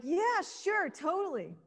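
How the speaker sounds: high, bright-pitched casual "yeah, sure"; breezy agreement. Retold or imitated. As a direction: toss it off high like a bored teen.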